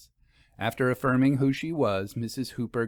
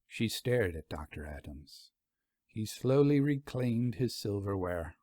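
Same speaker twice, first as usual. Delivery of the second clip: plain reading voice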